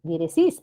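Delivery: "diéresis" stressed on the last syllable, diere-SIS; worse wrong stress. Shown as a demonstration. Teacher showing an even worse mistake, "diéresis" mis-stressed as diere-SÍS. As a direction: push the beat of "diéresis" to the final SIS. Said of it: "peor todavía".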